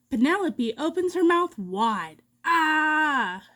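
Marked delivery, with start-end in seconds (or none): 2.4-3.4 short scared scream; startled shout. Acted. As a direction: yelp in fright. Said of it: "shouts Edna".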